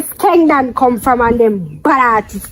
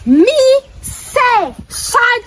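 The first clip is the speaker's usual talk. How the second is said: high, word-by-word warning; heated threat; 9 semitones higher, much slower than usual. Real, not acted. Giving her a threatening message for her man, heatedly. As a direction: punch out each word high, with gaps.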